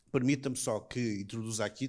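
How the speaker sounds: ordinary delivery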